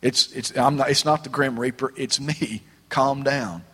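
soft, hushed comfort; Jesus calming the disciples, softly; gentle reassurance. Acted. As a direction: soothe frightened friends quietly. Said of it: "He said".

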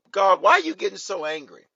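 loud, high-pitched mock complaint; hammed-up Moses. Moses projecting his anger onto God, loud high outcry. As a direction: cry out at God in exaggerated protest. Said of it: "Moses said".

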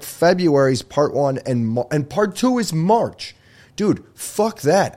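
rising, slow, exasperated complaint; mock outrage. Real, not acted. Outraged about split season.

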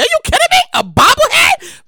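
can't believe it's real; excited disbelief. Real, not acted. Excited disbelief at bobblehead news.

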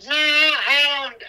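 slow, loud protest; loud indignant denial. Real, not acted. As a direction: push back loudly.

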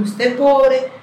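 condescending. Retold or imitated. Interviewer belittling her on air, loud condescending jab. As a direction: sneer down at her as if she were nothing. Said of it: "Me dijo".

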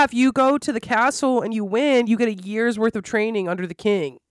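usual speaking voice

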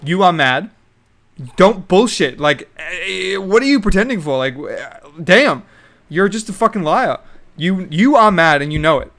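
loud, heated rant that jabs at the listener; mock angry callout. A viewer telling off the contestant with a loud, heated rant. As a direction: tear into her, calling out her lies. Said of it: "It's like".

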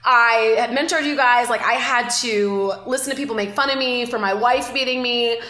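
ordinary talk, nothing marked

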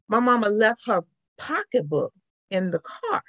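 ordinary delivery

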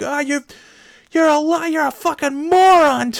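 high, loud mock yell at the other driver; retold screaming match. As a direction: yell it back up high like a furious stranger. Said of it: "we just start screaming at each other".

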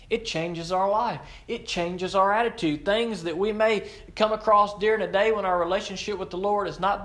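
stirring the congregation, fervent preaching; preaching climax. Real, not acted. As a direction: lift it up and preach it with rising passion.

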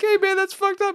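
very high, loud protest; mock outraged crowd. Audience scolding the comedian, high mock outrage. Crowd objects in retold bit.

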